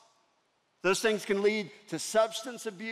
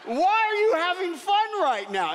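put-on high, loud child outrage at the laughing kids; indignant little kid; 9 semitones higher, 3 dB louder than usual. Lost boy scolding the playing kids, high and loud. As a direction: play the upset three-year-old, much higher and louder. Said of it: "and I'm like".

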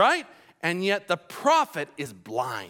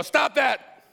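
higher mock parrot outburst; parrot imitation; 8 semitones higher, 4 dB louder than usual. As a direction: imitate an annoyed parrot, pitched up. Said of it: "the other one would go".

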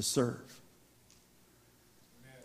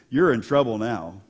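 ordinary delivery

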